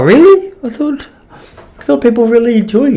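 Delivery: a sudden high incredulous really; surprised. Retold or imitated. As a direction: caught off guard by a friend's criticism, surprised. Retelling his shocked reply.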